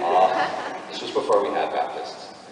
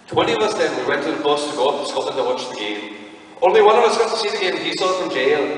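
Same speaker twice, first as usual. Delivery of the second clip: higher, lilting Welsh accent practice paragraph; 5 semitones higher, 5 dB louder than usual. Shown as a demonstration. Reciting the accent-class paragraph for Welsh, Welsh accent. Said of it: "the one for Welsh is always funny".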